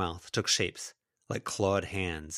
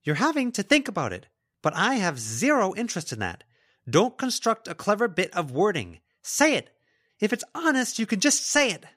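loud, high, heated demand; angry insistence; 12 semitones higher, 6 dB louder than usual. Acted. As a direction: push hard, peaking on the short order. Lila keeps demanding an answer.

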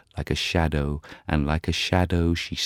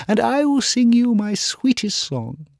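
nightingale pleading; 5 dB louder than usual. Acted. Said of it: "she cried".

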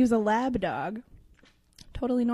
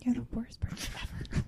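whisper; 8 dB quieter than usual. Real, not acted. Joke insult.

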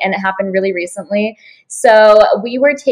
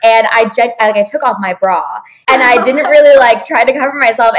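ordinary talk, nothing marked

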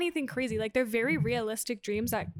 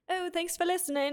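imitating a British singer's thanks, mock British accent; 6 semitones higher than usual. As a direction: do a quick jokey British accent. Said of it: "whatever the fuck his accent is".

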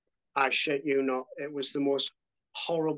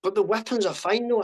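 higher, louder imitation of dad's disbelief on "now"; the father refusing to accept it, pleading; mock incredulous father, pitch up; disbelieving dad; 7 semitones higher, 5 dB louder, faster than usual. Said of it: "He goes".

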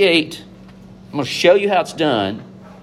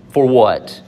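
usual speaking voice

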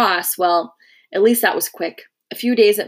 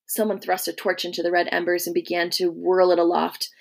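ordinary delivery

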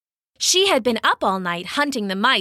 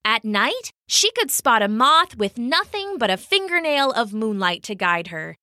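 usual speaking voice

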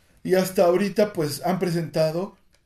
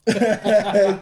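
bursting out laughing; loud helpless laugh; loud laughter; 6 semitones higher, 9 dB louder than usual. Real, not acted. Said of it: "Ya no me pude aguantar la risa".

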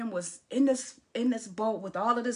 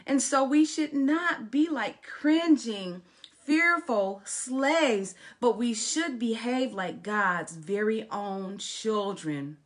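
forceful pulpit delivery; emphatic scripture reading; 2 semitones higher, 5 dB louder, slower than usual. Acted. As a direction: preach the verse, stretching the last words. Reads scripture while preaching.

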